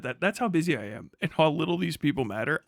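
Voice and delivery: ordinary delivery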